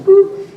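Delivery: mock machine beep, high and loud. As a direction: squeak out the voicemail beep. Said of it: "voicemail beep imitation".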